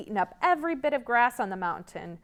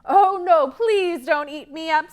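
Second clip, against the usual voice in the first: high, louder begging; pleading goat; 7 semitones higher, 8 dB louder than usual. Acted. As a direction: plead with the troll. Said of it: "said the medium-sized billy goat".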